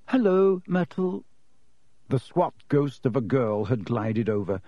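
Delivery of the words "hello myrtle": nervous schoolgirl. Acted. Hermione greeting Myrtle nervously.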